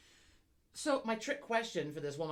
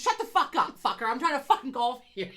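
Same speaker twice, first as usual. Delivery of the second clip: loud furious outburst, high and shouted; shouted rage; angry yell; 6 semitones higher, 10 dB louder than usual. Pretend. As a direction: scream it at the annoying golf partner. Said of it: "you look at her or him, and you just".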